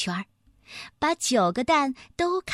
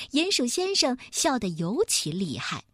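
ordinary delivery, nothing marked

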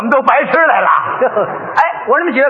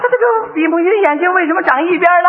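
higher-pitched puzzled repeat of the question; flustered stalling; 6 semitones higher than usual. Acted. Father still stuck on son's question, mildly flustered. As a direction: play stumped, voice pitched up.

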